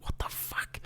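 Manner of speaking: hushed mock disbelief; stunned whisper. Podcaster voices the ant under giant feet, stunned whisper. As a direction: whisper it as if dumbfounded. Ant's stunned reaction, acted.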